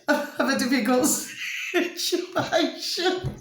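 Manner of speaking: last word stretched out and swallowed by laughter; high, helpless giggle through the words; real giggling fit. Real, not acted. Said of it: "this giggling bit".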